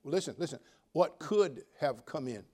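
usual speaking voice

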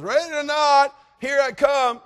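high, loud sing-out; seeker's call. Retold or imitated. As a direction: holler it across the yard. Seeker calls out in game.

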